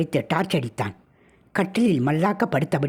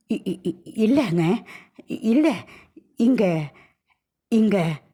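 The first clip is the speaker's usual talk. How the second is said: frightened stammer; 4 semitones higher, much slower than usual. Acted. Terrified wife stammering to husband.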